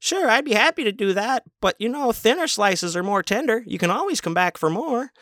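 very high chipper customer-service voice; buffet worker to customer, mocked high and chirpy; mock trainee voice. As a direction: chirp it like an eager eighties server. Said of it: "say this".

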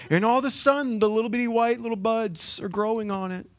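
gleeful gardener; delighted. Real, not acted. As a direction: share the surprise of new buds. Excited about plant buds.

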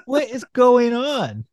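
high, slow baffled question; drawn-out disbelief; incredulous. Real, not acted. Disbelief at Wolverine's bandages.

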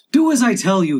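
high, loud rabbit's command; cross, high-pitched insistence; angry order. Acted. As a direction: insist angrily that the servant obey. Rabbit orders reluctant servant.

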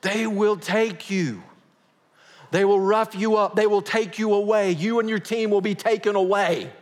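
high, loud dread-filled warning; worried pastor. Retold or imitated. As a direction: warn him with dread. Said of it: "He goes".

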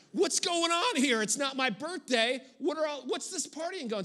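put-on the outraged older brother demanding answers; indignant brother. Older brother protests the party, high and indignant.

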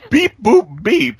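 high robot beeping; droid beeps. Pretend. [name] answering in Star Wars droid beeps. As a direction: beep like a little droid.

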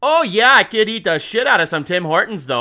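bad Canadian accent. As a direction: belt out a bad Canadian impersonation. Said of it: "I was doing Canadian".

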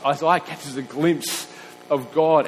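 ordinary delivery

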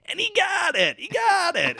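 very high-pitched, loud catch call; George Grand. Retold or imitated. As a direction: shout it like an excited TV baseball announcer. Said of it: "like, really high-pitched voice".

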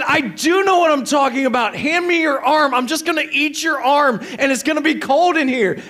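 high, loud, heated insistence; acted yelling. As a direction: rant like a delirious half-asleep husband. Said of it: "You are screaming".

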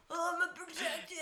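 high, strained climaxing vow; Harry Truman. Acted. Sheriff climaxing over protecting Josie, strained high voice. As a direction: squeeze it out in a hammed-up put-on voice. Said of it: "coming based on the fact of how much he's gonna protect".

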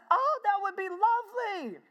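very high, loud mock-female gush; Harry's wife, delighted. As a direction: gush as a thrilled diner wife. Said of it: "She said".

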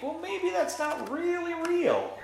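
very high-pitched musing; wavering doubt. Acted. Person led to doubt what is real, wavering.

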